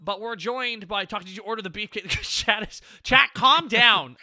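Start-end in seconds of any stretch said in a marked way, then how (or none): 3.0-4.2 loud. Real, not acted. Scolding the excited chat.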